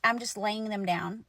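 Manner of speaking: ordinary delivery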